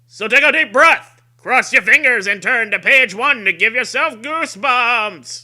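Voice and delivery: loud, high announcer finish; big trailer finish. Acted. Host ends the intro like a booming announcer. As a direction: build it to a grand hammy climax.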